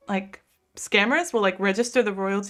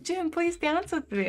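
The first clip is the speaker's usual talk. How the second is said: pleading friend; 7 semitones higher, 4 dB quieter than usual. Retold or imitated. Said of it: "she's like".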